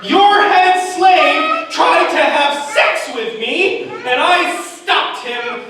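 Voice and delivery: high, loud, slow outraged yell; yelling for husband. Retold or imitated. Wife yells for her husband Potiphar accusing Joseph, loud and slow. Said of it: "she yells for her husband".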